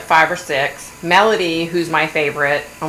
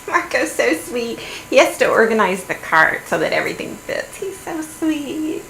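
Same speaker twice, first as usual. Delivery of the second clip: mock gushing wife; 4 semitones higher than usual. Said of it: "and she's like".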